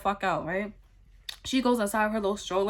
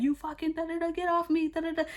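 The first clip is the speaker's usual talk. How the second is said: mock yell; 8 semitones higher, faster than usual. Girlfriend fighting over her purse, retold as a mock yell. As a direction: squeal it as a held-back mock yell. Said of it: "yelling, yelling, like".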